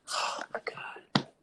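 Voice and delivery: breathless whispered "Oh my God"; overwhelmed whisper. Retold or imitated. Moved to tears by a friend's message, whispering.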